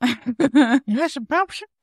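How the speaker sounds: put-on very high and slow old man; drawn-out high creepy voice. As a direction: channel a leering old man. Mocking voice like Herbert.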